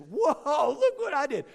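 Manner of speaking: put-on high, astonished gasp of a boy; wide-eyed wonder at his own hit; amazed kid. Boy marvelling at the ball he just hit, amazed.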